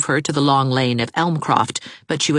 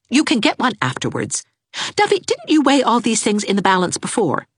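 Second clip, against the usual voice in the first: firm, higher order; firm young woman; commanding Anne; 9 semitones higher than usual. A narrator's reading. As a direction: order her out of bed.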